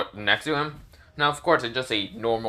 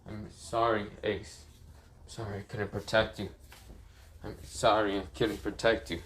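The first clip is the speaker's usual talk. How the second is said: soft, lowered, mildly sorrowful; quiet apology; 4 semitones lower, 8 dB quieter than usual. Acted. Luffy apologizing to Ace for failing him, quiet mild sorrow. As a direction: apologize softly to a lost brother. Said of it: "this is where Luffy says".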